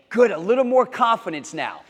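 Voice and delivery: ordinary delivery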